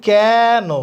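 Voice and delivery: "কেন" with a wide, stretched first vowel, loud; open vowel. Shown as a demonstration. Teacher showing the class the sound, open vowel in "কেন". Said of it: "তাহলে এটাকে আমরা বলছি".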